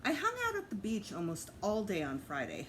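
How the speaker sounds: plain reading voice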